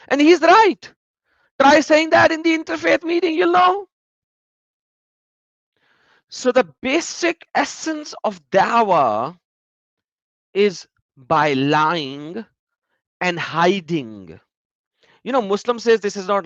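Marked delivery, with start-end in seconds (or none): 0.1-3.9 high, loud sarcastic jab. Real, not acted. Sarcastic challenge to viewers. 6.3-14.4 slow, weighty accusation; slow emphatic. Real, not acted. Drawn-out accusation.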